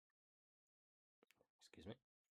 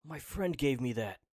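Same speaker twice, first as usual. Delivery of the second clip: loud acted shout, pitched down a little; forceful shout; 4 semitones higher, 13 dB louder than usual. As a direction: belt it out firmly, less high. Second retake, lower, then approved.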